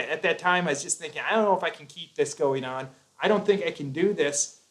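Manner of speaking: usual speaking voice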